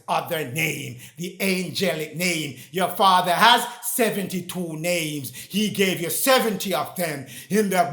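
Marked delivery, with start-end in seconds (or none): none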